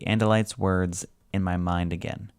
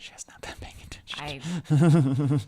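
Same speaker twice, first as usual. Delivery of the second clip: slow teasing whisper; 7 semitones higher than usual. Real, not acted. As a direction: whisper it like tattling on her. Teasing aside about listener.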